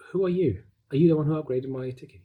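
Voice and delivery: confrontational demand. Retold or imitated. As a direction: challenge the stranger on the phone, quickening. Confronts the upgrader by phone.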